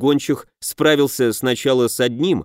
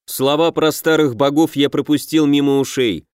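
ordinary delivery

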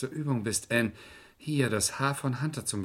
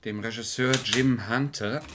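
slow dictation. Acted. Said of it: "Bin ich zu schnell?".

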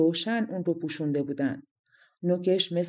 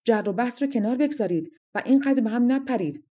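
raised, higher-pitched scolding; shouted order; 6 semitones higher, 4 dB louder than usual. A narrator's reading. Fairy breaking up the quarrel, shouting moderately. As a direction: call out to stop the squabble. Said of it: "پری داد زد".

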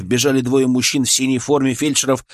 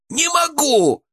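terrified wail; slower than usual. A narrator's reading. Chipmunk refusing to dive in, high terrified wail. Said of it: "завопил Бурундук".

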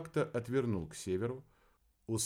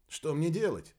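higher, louder worried plea; anxious question; 7 semitones higher, 5 dB louder than usual. Acted. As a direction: ask urgently, at a loss. Captain asks in confusion.

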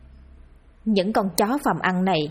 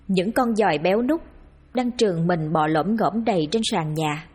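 plain reading voice

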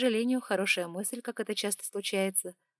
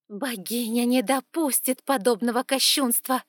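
hissing like a snake; 4 semitones higher, 8 dB louder than usual. Acted. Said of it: "змеёй зашипела".

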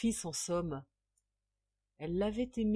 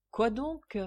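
higher, puzzled question; taken aback; 6 semitones higher, 4 dB louder than usual. A narrator's reading. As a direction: ask it nonplussed. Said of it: "fit Ragdal de nouveau interloqué". (interloqué)

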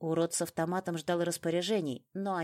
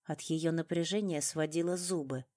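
ordinary delivery

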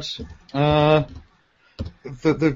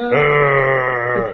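mock roar; 3 dB louder than usual. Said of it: "Pauline Quirk going".

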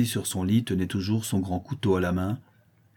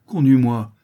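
"conduis-moi" repeated much louder, begging hard; desperate loud plea; 4 semitones higher, 9 dB louder than usual. A narrator's reading. Bandit begging the boy to lead him, loud desperate plea. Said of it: "d'une voix presque suppliante".

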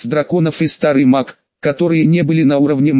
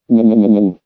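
ghostly weeping wail; 5 semitones lower than usual. A narrator's reading. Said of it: "Звук, похожий на плач призраков".

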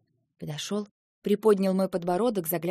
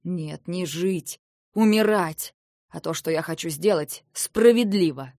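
slow, then loud bursts of rage; furious; 7 dB louder, slower than usual. Acted. Lentar justifying revenge to Eva, furious. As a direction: hammer each word home. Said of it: "Яростно обернулся он".

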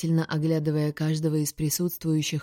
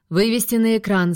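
higher, louder officer's order; captain's command, raised in pitch; 5 semitones higher, 5 dB louder than usual. A narrator's reading. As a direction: give a crisp order to the bridge crew. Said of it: "скомандовал я".

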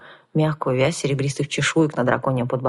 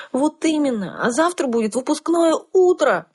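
put-on young girl voice; 11 semitones higher than usual. A narrator's reading. Heroine argues with teacher.

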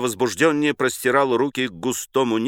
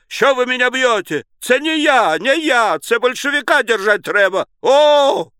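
high, thin, desperate voice; high howl ending in a loud cry; wailing protest; 11 semitones higher, 4 dB louder than usual. A narrator's reading. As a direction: plead innocence in a panicked wail. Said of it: "завопил он".

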